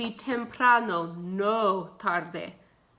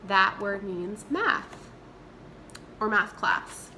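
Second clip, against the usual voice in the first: normal talking voice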